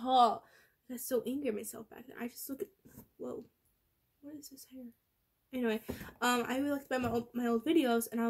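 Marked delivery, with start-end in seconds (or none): 3.2-4.9 breathy shocked whisper. Real, not acted. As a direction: hush it to yourself in disbelief. Shocked at old photo, whispering.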